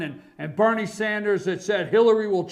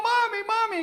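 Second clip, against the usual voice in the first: imitating a little girl calling; 13 semitones higher than usual. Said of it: "come in and yell".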